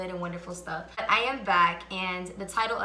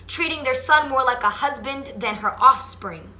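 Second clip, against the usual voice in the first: louder, earnest spoken-word recital; spoken word poem; 3 semitones higher, 9 dB louder than usual. Acted. Reciting her poem about absent fathers to viewers, earnest spoken word. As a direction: deliver each line like a performance poet.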